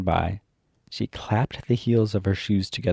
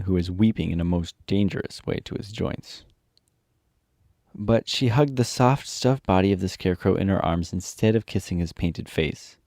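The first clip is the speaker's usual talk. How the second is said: ordinary delivery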